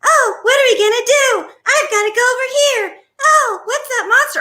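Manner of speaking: very high, overexcited mock squeal; high, exaggerated kids'-video voice; bad YouTuber voiceover. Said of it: "a lot of these YouTube people".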